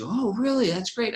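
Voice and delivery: high, mock-bright wife voice; imitating the wife. Retelling the wife at dinner, high mock voice. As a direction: play the wife, pitched well up.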